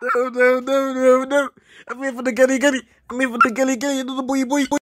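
high-pitched frantic refusal; high, comic recoil in disgust; mock horror. Real, not acted. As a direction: protest in a high, panicky squeal. Recoiling from a tomato-like image.

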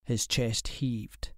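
ordinary delivery, nothing marked

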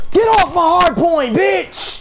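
high, loud gamer rage; angry yell. Real, not acted. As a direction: bark it at the enemy. Yelling at enemy in game.

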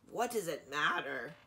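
ordinary delivery, nothing marked

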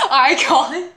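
giggling the word back; laughing. Retold or imitated. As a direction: repeat it through a laugh. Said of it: "they're like".

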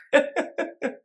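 airy giggle; breathless laugh. Real, not acted. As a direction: laugh silently, just breath. Keeps laughing at card.

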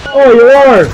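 loud excited shout. Real, not acted. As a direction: shout it out on spotting someone. Spotting opponent nearby.

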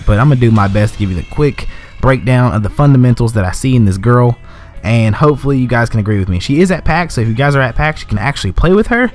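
usual speaking voice

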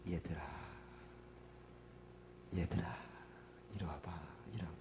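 soft, whispered beckoning; gentle whispered call to the tired disciples; Jesus' tender call. Acted. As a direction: draw them close in a hush. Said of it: "주님은 그렇게 하지 않았어".

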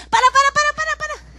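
high, loud frantic para; panicked shout. Retold or imitated. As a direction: scream stop at the wheel. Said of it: "le estás diciendo". (gritando para con pánico)

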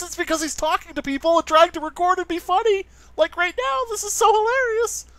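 climbing to a very high, loud squeal; put-on annoying rant. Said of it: "Not annoying at all".

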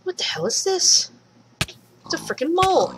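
high, startled outburst at a strange mole. Real, not acted. To a mole that suddenly appears, high startled outburst. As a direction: shoot up high in surprise.

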